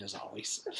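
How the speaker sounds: plain reading voice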